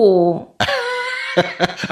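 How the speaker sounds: high, airy delighted laugh. Acted. He laughs at her teasing.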